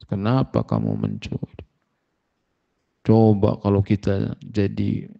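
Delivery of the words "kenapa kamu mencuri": much lower and quieter "Kenapa kamu mencuri?"; gentle asking. Retold or imitated. Sheikh bin Baz gently asks the caught thief "Kenapa kamu mencuri?", low and soft. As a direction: drop the voice low and ask "Kenapa kamu mencuri?" softly, without blame.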